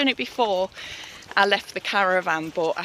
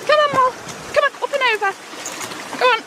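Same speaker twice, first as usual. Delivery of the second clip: coaxing dog voice; much faster than usual. Real, not acted. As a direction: urge the dog up cheerfully. Coaxing dog over rocks.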